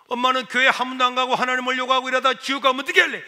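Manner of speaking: acted hurried warning; urgent pleading son. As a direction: plead in a rush with mother. Son urgently argues with mother.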